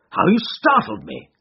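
high-pitched, startled exclamation; startled cry. A narrator's reading. Watson startled by Holmes's sudden appearance, high astonished cry. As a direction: cry out in surprise, pitched right up. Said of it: "I cried".

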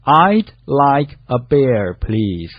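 very slow, word-by-word repeat; slow model reading. Shown as a demonstration. Co-host echoes the teacher's review sentence, slowly. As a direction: draw each word out for learners.

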